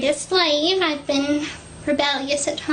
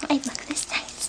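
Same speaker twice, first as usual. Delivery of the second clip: whisper; 9 dB quieter than usual. Real, not acted. Coy aside about her dress.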